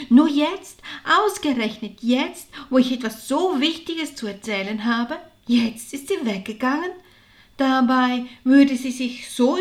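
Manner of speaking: loud, emphatic grumble; exasperated complaint. A narrator's reading. Martha with big news finds her sister gone, exasperated complaint. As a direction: complain with heavy emphasis on the key words.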